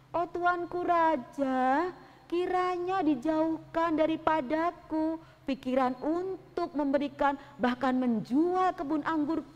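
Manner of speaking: a humble farmer refusing sadly. Acted. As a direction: Nabot gently pleading with the king. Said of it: "dengan perasaan yang sangat-sangat sedih".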